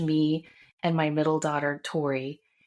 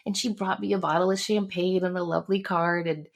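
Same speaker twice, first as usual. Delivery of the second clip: ordinary delivery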